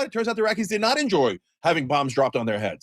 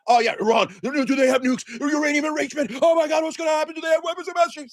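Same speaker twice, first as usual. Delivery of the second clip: rising, higher panicked mock-alarm; sarcastic pundit parody, climbing in pitch; mocking hawkish media; 9 semitones higher than usual. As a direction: ham up a breathless pundit fearmongering. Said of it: "Same media is like".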